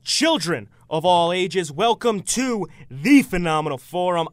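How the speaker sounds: loud booming ringmaster announcement; big top ringmaster welcome. Acted. Host opens show like circus.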